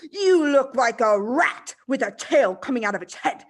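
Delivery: loud, sneering shout. Acted. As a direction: shout the insult in her face.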